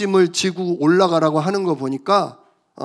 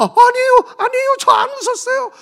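much higher, hurried denial; flustered Sarah; 3 dB louder than usual. Acted. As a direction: caught out and protesting innocence. Sarah denies laughing. (높고 당황한 목소리로 부인하는 사라)

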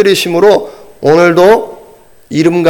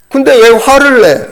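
wife asking; 8 semitones higher, 4 dB louder than usual. Retold or imitated. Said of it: "그렇게 묻는 거예요".

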